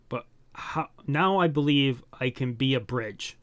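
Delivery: ordinary talk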